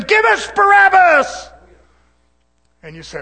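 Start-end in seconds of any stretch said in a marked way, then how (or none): 0.0-1.5 long, high, very loud scream; screaming crowd member. Acted. Preacher acting the crowd choosing Barabbas, drawn-out high screaming. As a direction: scream the demand, stretching every word. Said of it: "the one that was screaming".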